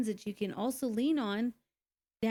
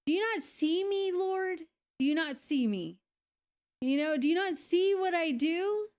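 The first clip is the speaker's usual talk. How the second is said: higher, pleading, half-breaking retold cry to God; retold desperate plea; 7 semitones higher than usual. A single mom, car broken down, crying out to God in a desperate plea. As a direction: beg like you're at the end of your rope. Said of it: "I'm crying".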